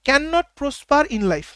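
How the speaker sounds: very slow, word by word; slow dictation. Shown as a demonstration. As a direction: spell it out slowly for the class. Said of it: "আমি একটু বলে দিই".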